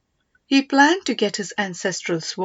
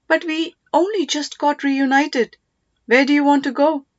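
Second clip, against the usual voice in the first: startled plea; 6 semitones higher, 4 dB louder than usual. Acted. Son pleading with his departing mother in a dream, startled. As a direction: caught off guard as she leaves.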